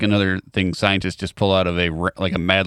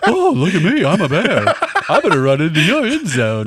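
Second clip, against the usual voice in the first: bear voice; 7 semitones higher, 4 dB louder than usual. Pretend. Mocking the bear voice.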